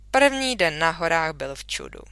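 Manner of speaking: usual speaking voice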